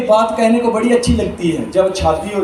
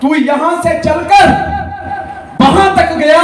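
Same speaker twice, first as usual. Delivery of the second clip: emphatic; 6 semitones higher, 4 dB louder than usual. Real, not acted. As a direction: drive the point home loudly. Emphatic lesson to the child.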